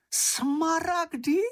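very high, drawn-out incredulous question; amazed high-pitched little voice; astonished exclamation. A narrator's reading. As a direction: squeal the word in disbelief, stretched out. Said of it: "zvolal Čenich".

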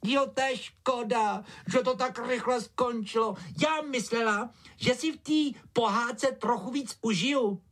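high-pitched, mildly disappointed story character; Ája, regretful. Acted. Ája telling Fík it ended too soon, high disappointed voice.

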